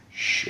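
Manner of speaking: soft, drawn-out "shh"; secretive shush. Retold or imitated. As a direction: hush a kid to keep a secret. Batman telling boy to keep secret.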